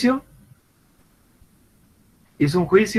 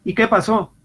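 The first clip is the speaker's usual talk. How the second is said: sharp father's question, pitched up; father asking; 8 semitones higher than usual. Retold or imitated. Father comes home and asks what happened, stern. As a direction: demand to know what happened. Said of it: "llega el papá".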